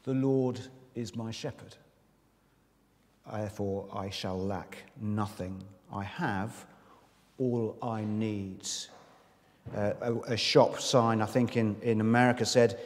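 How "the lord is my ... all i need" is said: soft, slow scripture quote. Shown as a demonstration. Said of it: "all you need to know this morning is".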